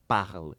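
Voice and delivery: parle with a guttural French R. Shown as a demonstration. Showing the listener France's cultural R, guttural French R. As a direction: say parle with the R rasped in the throat. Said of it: "o francês você tem um R cultural".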